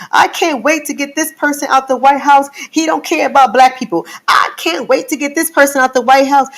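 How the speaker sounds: high, insistent mock voter voice; high, worked-up imitation; eager voter. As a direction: play fired-up voters ranting. Mocking voters eager for change.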